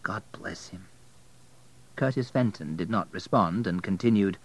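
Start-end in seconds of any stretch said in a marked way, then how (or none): 0.0-0.8 soft private blessing; murmured under breath. A narrator's reading. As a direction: keep it to yourself, barely aloud. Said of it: "Abel murmured under his breath".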